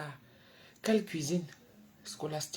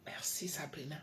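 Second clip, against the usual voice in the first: soft unvoiced whisper; 2 semitones lower, 12 dB quieter than usual. Real, not acted. Whispering late at night on the live. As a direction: hush it so nobody wakes.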